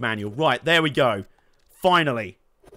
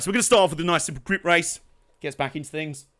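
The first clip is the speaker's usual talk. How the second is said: ordinary delivery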